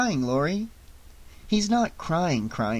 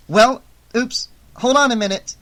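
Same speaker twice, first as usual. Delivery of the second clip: higher, louder sudden oops; startled; 9 semitones higher, 5 dB louder than usual. A narrator's reading. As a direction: caught off guard mid-sentence. Dog attacking during call.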